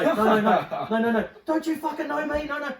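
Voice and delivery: high, loud, laughing mock protest; hammed-up frantic denial, half laughing; pleading boyfriend. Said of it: "he's trying to say".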